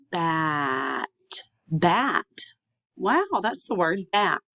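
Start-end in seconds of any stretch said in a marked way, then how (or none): 0.1-1.1 BAT drawn out slowly, final t separate; stretched out. Shown as a demonstration. Teacher blending sounds for students, BAT slowly stretched out. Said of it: "say it slowly and stretched out". 1.7-2.2 BAT said quickly as one word; said faster. Shown as a demonstration. Teacher showing students the finished word, BAT said faster. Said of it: "then you can say it faster".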